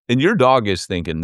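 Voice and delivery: normal talking voice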